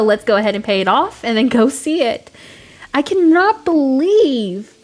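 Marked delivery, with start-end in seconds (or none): 2.9-4.7 slow, high, drawn-out disbelief; can't believe it finally worked; amazed disbelief. Real, not acted. Finally placed the stubborn bridge.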